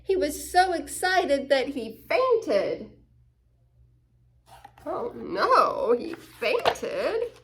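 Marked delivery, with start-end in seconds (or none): 4.8-7.3 mock dismay. Real, not acted. Reader reacts to fainting.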